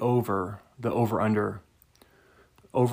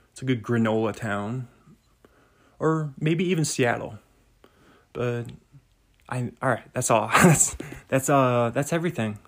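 ordinary talk, nothing marked

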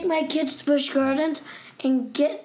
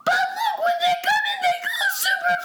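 very high, squealing, laughing panic; panicked kid voice. Retold or imitated. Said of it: "he went like this".

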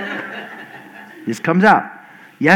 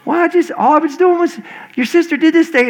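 higher-pitched pleading excuse; mildly whiny self-justifying; defensive parent; 9 semitones higher than usual. Retold or imitated. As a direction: explain yourself to an upset child, higher. Excuses himself to crying son.

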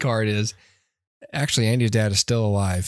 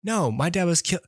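higher, rising confusion; mock dawning shock; 6 semitones higher, faster than usual. Host voicing Buzz realizing his dad was killed. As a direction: act out the hero's growing alarm.

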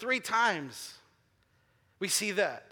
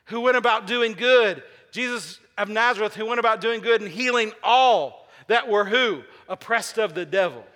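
preaching at full force; 4 dB louder than usual. Acted. Preaching build, congregation call.

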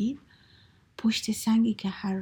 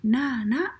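loud, raised refusal from a storybook tiger; very angry; 4 semitones higher, 6 dB louder, slower than usual. A narrator's reading. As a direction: shout it crossly, higher and louder. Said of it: "خیلی عصبانی شده بود". (فریاد عصبانی ببر)

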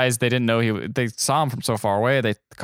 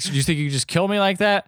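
a touch higher, mock outraged; lightly voiced indignant man; 7 semitones higher than usual. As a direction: challenge her in a hint of a put-on voice. Voicing George Clooney confronting her.

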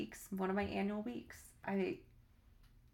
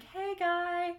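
high sweet voice; 8 semitones higher than usual. Retold or imitated. Acted video message to guy.

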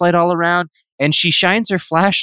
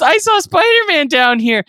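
put-on kid voice; 12 semitones higher than usual. Little brother repeating.

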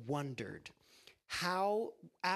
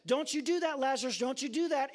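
high, louder scolding threat; threatening; 8 semitones higher, 4 dB louder than usual. Acted. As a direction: wag a finger and warn. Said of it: "someone trying to threaten".

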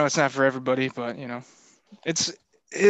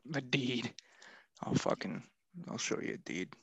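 mock menacing threat; 14 dB quieter than usual. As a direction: mutter a joke threat under your breath. Mock threat over the word deed.

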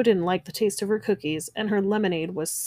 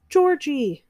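high, moderately raised call of a name; narrated cry; 7 semitones higher, 4 dB louder than usual. A narrator's reading. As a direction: call out as a joyful crowd would, storybook style. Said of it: "everyone let out a huge cry".

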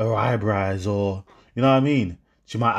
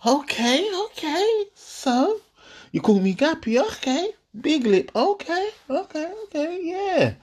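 much higher squeaky okays; mock shocked. Boyfriend reacting to being called gappy, much higher squeaky mock shock.

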